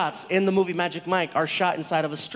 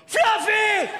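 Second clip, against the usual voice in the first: high excited shout of a nickname; yelled greeting; 13 semitones higher than usual. Retold or imitated. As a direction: shriek the name like starstruck fans. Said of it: "all jumped up and yelled".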